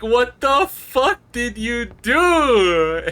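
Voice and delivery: high, loud, very slow mock screech; mock furious housekeeper. Maid finding the oily bird mess, high slow mock screech. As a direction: shriek it like a scandalized maid. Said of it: "She's like".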